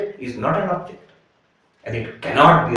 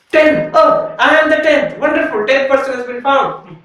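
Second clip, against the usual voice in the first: joyful discovery; 11 semitones higher, 6 dB louder than usual. Acted. Counter discovers he is the tenth.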